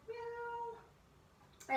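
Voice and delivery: soft, high kitty meow; tiny high-pitched meow; cat meow. Shown as a demonstration. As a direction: mew very quietly, like a kitten. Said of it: "meow like a cat".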